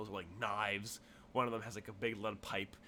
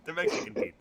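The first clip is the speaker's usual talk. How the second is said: laughing; 8 semitones higher, 22 dB louder than usual. Real, not acted. GM cracking up at joke.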